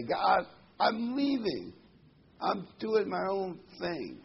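high, drawn-out announcement to God; stretched-out, acted proclamation; mock declaration. Acted open defiant farewell to God.

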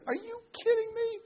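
very high, shrill-free outraged cry of disbelief; outraged emperor. Acted. Raging emperor to Daniel's friends, furious cry. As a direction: rage at them in disbelief.

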